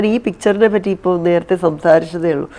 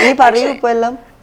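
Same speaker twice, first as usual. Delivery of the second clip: teasing quiz; 5 semitones higher, 5 dB louder than usual. Real, not acted. Quizzing interviewer like a teacher.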